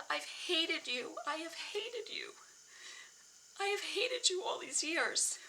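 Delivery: high, tearful, aching confession; emotional confession. Real, not acted. Woman confessing to her younger self, genuinely choked up. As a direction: confess it with the voice lifted, close to crying. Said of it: "I'm, like, getting emotional even talking about it now".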